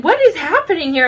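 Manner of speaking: indignant demand. Retold or imitated. Aunt confronting the woman yelling at her niece, indignant demand.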